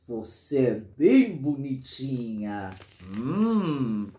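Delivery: low, slow creepy uncle voice; drawn-out low character voice ending in a hum; different, suggestive tone. Acted. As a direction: drop deep and linger, a predator's false compliment. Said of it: "ele disse com tom de voz diferente".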